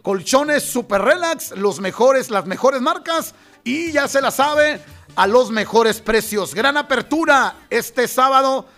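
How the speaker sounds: upbeat announcer pitch for a store; lively commercial read; radio ad read. Acted. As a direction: sell the opening day like a radio spot. Reads mattress store ad.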